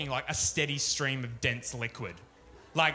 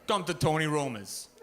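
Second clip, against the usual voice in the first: lightly voiced tough guy, slow on the last bit; a hint of the ad mobster signing off; mobster pitchman; 3 dB louder, slower than usual. Imagined restaurant ad guy closing, lightly voiced. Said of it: "Sopranos kind of guy".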